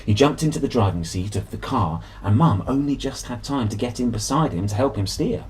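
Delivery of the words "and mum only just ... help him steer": ordinary delivery, nothing marked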